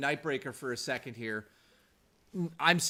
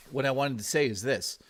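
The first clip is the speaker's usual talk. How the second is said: ordinary talk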